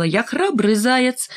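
put-on storybook child's bold cry, stretched out. A narrator's reading. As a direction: cry out a slow, high-pitched boast. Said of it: "и закричала".

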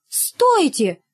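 teacher crying out in a high voice; teacher's cry. A narrator's reading. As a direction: call out to stop departing pupils. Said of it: "крикнула Изабелла Михайловна".

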